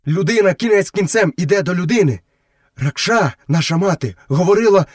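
high-pitched wolf character voice; wolf brother. Acted. Wolf telling Mowgli their mother's saying, high and earnest.

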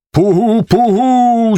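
high hooting Cossack call; owl-call cry. A narrator's reading. Vasyl calls the Cossack signal, high hoot. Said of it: "закричав".

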